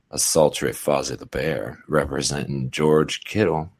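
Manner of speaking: low, soft, creepy sultry voice; a breathy seductive judge voice; sultry narrator. Acted. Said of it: "I just came out of that weird, creepy, sultry voice".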